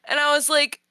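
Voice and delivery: usual speaking voice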